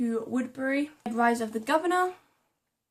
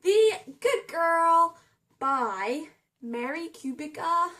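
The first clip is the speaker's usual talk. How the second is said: put-on higher voice, slow on the title; a silly higher voice that climbs; funny voice; 5 semitones higher, 3 dB louder, much slower than usual. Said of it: "I'm talking in a funny voice".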